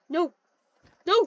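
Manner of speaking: ordinary delivery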